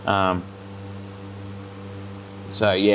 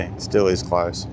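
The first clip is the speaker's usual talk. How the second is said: ordinary delivery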